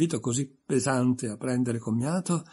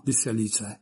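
ordinary talk

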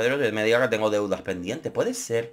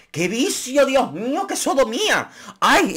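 mock scandalized; 12 semitones higher, slower than usual. Scandalized about the foursome.

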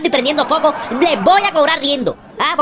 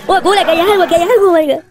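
high, louder panicked telling; frightened; 4 semitones higher, 3 dB louder than usual. Acted. Spooked friend tells his buddy about the ghost, high and panicked. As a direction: blurt out the fright.

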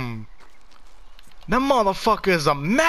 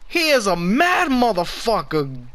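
normal talking voice

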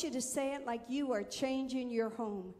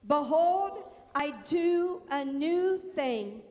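proclaiming scripture; 5 dB louder than usual. Acted. Preacher proclaiming scripture to church.

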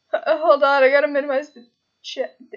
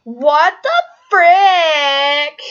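silly put-on voice, loud; goofy loud exclamation from a streamer; odd silly voice; 3 semitones higher, 12 dB louder, much slower than usual. Real, not acted. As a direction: blurt it out in a goofy cartoonish voice. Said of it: "Why am I talking like this?".

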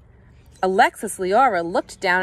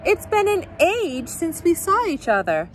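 gushing diva; 9 semitones higher than usual. Acted.

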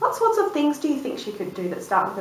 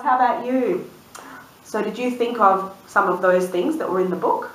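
usual speaking voice

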